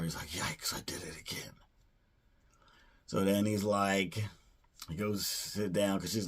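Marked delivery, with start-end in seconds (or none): 0.3-1.5 much softer, lower muttered aside; mock dismay. Kevin realizing he's in New York again, muttering. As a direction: mutter it under your breath.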